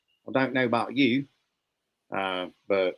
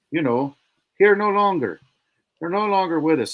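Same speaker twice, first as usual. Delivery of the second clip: usual speaking voice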